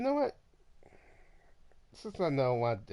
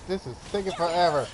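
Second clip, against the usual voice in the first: impatient; 6 semitones higher, 7 dB louder, faster than usual. Real, not acted. Impatient player mid-fight.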